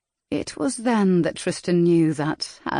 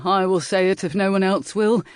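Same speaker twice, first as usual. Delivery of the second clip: proclaiming; 3 semitones higher, 4 dB louder than usual. A narrator's reading. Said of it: "Lord Fairford proclaimed".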